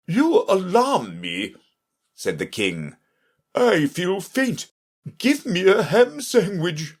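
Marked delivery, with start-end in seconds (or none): 0.1-1.6 high, drawn-out voice for a startled king; slow, high royal alarm. A narrator's reading. King to the messenger, alarmed. 3.6-6.9 high-pitched character voice for a fussy king; faint king. A narrator's reading. King begging the messenger for food, faint.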